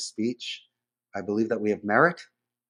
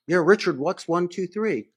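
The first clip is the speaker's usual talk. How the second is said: higher, pushy caller asking again; impatient caller; 7 semitones higher, 3 dB louder than usual. Retold or imitated. Caller repeating the question to the agent, pushy and higher. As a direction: play the irritated customer repeating the question.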